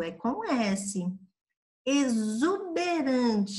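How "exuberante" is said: "Exuberante" very slow, X voiced as Z; drawn-out teacher's "Exuberante" with a clear Z; demonstrating the Z sound. Shown as a demonstration. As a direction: stretch "Exuberante" so the buzzing Z is heard. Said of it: "já está com som de Z".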